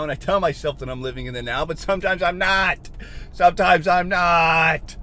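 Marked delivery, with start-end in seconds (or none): 1.6-2.9 slow, higher-pitched frustrated admission; drawn-out frustration. Real, not acted. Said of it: "you gotta listen to me scream". 3.3-5.0 loud, very slow frustrated yell; drawn-out scream. Real, not acted. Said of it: "you gotta listen to me scream".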